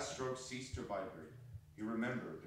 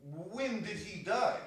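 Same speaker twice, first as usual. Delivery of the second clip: higher, slow put-on voice; merchant voice; 9 semitones higher, 4 dB louder, slower than usual. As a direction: ask like a prying merchant.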